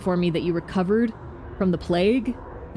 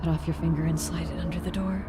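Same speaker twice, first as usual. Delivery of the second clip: softer, eerie voice behind the door; changed voice; 6 dB quieter than usual. Acted. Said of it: "Or your voice just changed?".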